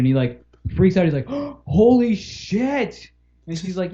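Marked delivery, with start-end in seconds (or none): 1.3-3.1 awestruck, drawn out; stunned gasp. Retold or imitated. Kid realizes the lady is Bart, stunned and slow. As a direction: gasp then a slow, high holy shit.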